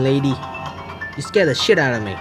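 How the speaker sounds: ordinary delivery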